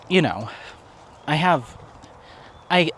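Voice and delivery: normal talking voice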